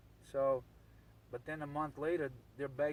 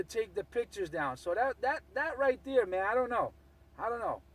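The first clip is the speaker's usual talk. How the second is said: raised voice, demanding answers; heated and loud; 6 semitones higher, 6 dB louder than usual. Real, not acted. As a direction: push back hard, getting louder. Heated challenge over the pictures.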